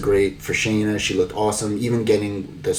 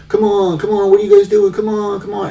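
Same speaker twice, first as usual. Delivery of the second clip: much higher, louder hammed-up hurry-up; mock urgent shout; 12 semitones higher, 7 dB louder than usual. Backstage official hurrying the EMTs along, high hammed-up shout. Urging EMTs in a retold scene.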